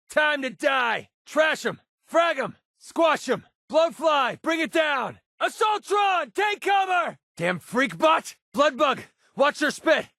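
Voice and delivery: high shouted combat orders; battle callouts. Acted. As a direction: yell at enemies mid-fight.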